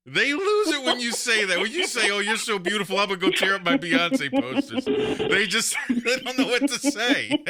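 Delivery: high-pitched, tickled retelling breaking into laughter; laughing while talking. Real, not acted. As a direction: tell it through mounting laughter. Cracking up at own joke.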